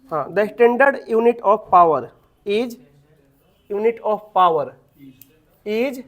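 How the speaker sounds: very slow, measured dictation. Real, not acted. Teacher dictating the unit of power to students, slow dictation. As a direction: leave time to write each word.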